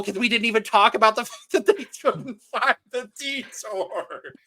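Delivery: words stretched and broken up by laughing. Real, not acted. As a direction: talk through helpless laughter. Laughing over the contestants' failure.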